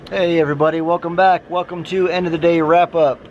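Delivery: ordinary delivery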